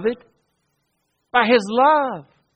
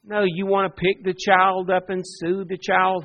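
ordinary talk

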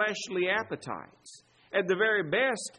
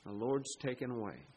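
softer, low, reverent quoting of Job; hushed reverent quote; 7 semitones lower, 10 dB quieter than usual. Retold or imitated. Preacher quoting Job in a hushed, reverent voice. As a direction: lower the voice as if praying the words. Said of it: "Or would we say like Job".

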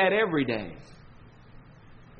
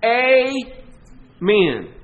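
loud Southern "Amen", a long punched AY, a pause, then MEN; emphatic Southern "Amen" split into two beats; Southern emphasis; 3 semitones higher, 6 dB louder than usual. Shown as a demonstration. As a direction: draw out a Southern AY-men, hard on the first syllable. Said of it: "special emphasis down here in the South".

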